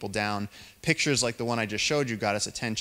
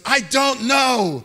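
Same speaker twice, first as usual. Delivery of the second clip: scared call; 10 dB louder, much slower than usual. Retold or imitated. Frightened friend answering call.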